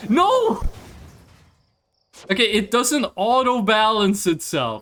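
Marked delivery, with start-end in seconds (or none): 0.1-0.6 sudden high, loud "no"; dismayed cry. Real, not acted. Player watching the model ship fail, high dismayed shout. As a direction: cry out as it goes wrong.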